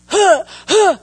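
acted out-of-breath panting; mimed gasping. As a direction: huff and puff as if winded. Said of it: "I can't breathe".

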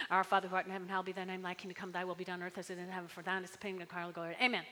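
fast, low, mumbled rote recital; rattled-off prayer. Shown as a demonstration. Pastor mocking a rote prayer, rattled off fast. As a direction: rush through it without meaning. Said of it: "so we can just say it really fast".

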